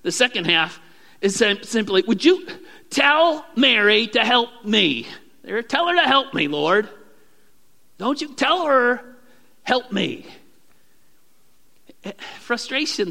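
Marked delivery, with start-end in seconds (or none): 2.1-10.3 louder, higher insistent request, slow and firm; bossy demand. Acted. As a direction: press Jesus hard to send Mary to help. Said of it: "the second thing she says".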